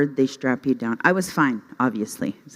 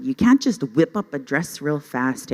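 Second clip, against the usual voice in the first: ordinary delivery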